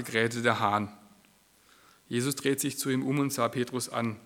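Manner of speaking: a measured Bible reading to the congregation; scripture reading. Acted. Preacher reading Peter's denial to the church, solemn reading. Reads Luke passage aloud.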